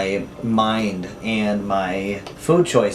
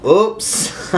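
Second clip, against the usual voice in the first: sudden high yelp of surprise; surprised oops; 9 semitones higher than usual. Real, not acted. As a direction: caught off guard. Surprised slip while unwrapping.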